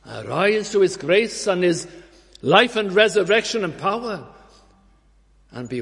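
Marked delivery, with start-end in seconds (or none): 0.1-4.3 rising preaching. Acted. Preacher questioning the young men, rising fervour. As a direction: appeal climbing higher and louder.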